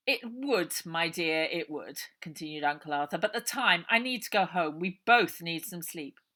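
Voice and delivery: an oily man humouring his lady friend; smooth undertaker. A narrator's reading. Uncle Arthur.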